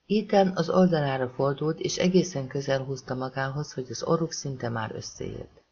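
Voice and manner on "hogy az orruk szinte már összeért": plain reading voice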